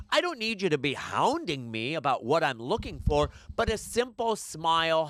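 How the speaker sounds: ordinary delivery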